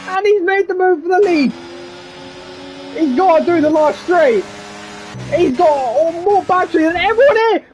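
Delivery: excited motor racing play-by-play; live race commentary. Real, not acted. Commentator calling the battle for the lead, excited. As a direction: call the race with urgent excitement.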